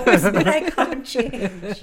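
loud and drawn out through laughter; real laughter in the voice; laughing. Real, not acted. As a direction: finish the joke while laughing. Joking about whitening herself.

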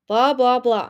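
loud, mocking brush-off; dismissive mockery. A narrator's reading. Anemone waving away her brother's speech, dismissive. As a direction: cut them off with scorn.

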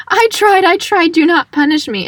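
high-pitched mock pleading; theatrical panicked pleading. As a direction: beg for mercy, hammed up and high. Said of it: "she's like".

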